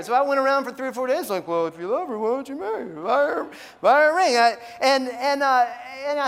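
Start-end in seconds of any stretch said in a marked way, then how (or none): 1.5-4.4 comic childlike impression, rising higher; Pee Wee Herman. Preacher repeating the words in his head, comic kid voice. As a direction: mimic a kid's voice, climbing higher as it goes. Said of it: "Pee Wee Herman would say".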